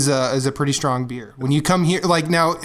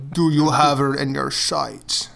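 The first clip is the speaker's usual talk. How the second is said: slow, faked Scottish burr; a Trainspotting lad doing Sean Connery, slow; 2 semitones lower, slower than usual. Mates taking off Sean Connery in a slow mock Scottish accent. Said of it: "the guys in the movie Trainspotting impersonating Sean Connery".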